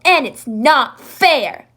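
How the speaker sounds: slow, loud stamped complaint; yelling. Acted. Jackal yelling it's not fair, slow and loud. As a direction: shout each word. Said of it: "yelled Jackal".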